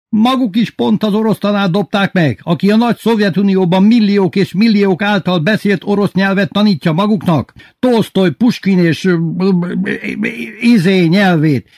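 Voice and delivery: high-pitched ranting, stumbling at the end; headmaster yelling. A narrator's reading. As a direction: shout grandly, then grope for names. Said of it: "hirtelen újra ordítani kezdett". (magas hangú, ordító tiráda)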